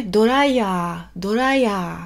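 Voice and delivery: "doraya" with a Japanese flap R and a lengthened final A; Japanese katakana pronunciation. Shown as a demonstration. Teacher modelling the Japanese word for hair dryer. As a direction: say "doraya" stretching the last A. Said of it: "on allonge le dernier A".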